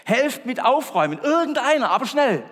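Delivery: very high, loud pleading shout; urgent shout. Acted. Man begs anyone to help clean, shouting. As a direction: yell for help, desperate. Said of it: "rief er".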